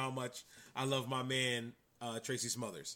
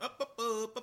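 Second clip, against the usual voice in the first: mock circus hup calls; 8 semitones higher than usual. Mocking circus acrobatics.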